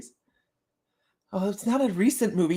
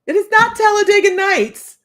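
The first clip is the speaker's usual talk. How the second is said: high, loud playful protest; loud mock correction; 12 semitones higher, 10 dB louder than usual. Real, not acted. To chat guessing the movie, loud mock protest. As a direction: shout the correction in mock outrage.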